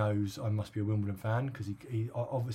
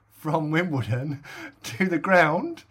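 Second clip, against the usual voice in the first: laughing through it; 4 semitones higher, 7 dB louder, much slower than usual. Real, not acted. Laughing while telling punchline.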